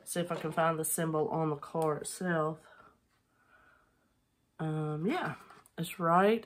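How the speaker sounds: normal talking voice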